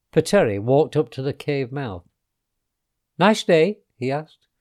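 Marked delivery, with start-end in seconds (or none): 3.2-3.8 lightly chirpy, higher and louder; an upbeat, slightly raised character voice; casual small talk. A narrator's reading. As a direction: toss out a friendly question. Pateri's cheery small talk to dinosaur.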